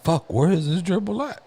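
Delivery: mock bafflement, rising through the line; puzzled searching. Boy retold hunting for missing gerbil, mock puzzled. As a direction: pitch climbing to a high questioning end.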